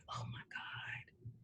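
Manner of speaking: breathless hushed whisper of wonder; awed whisper. Retold or imitated. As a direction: whisper it as if overcome. Awed whisper retelling reaction.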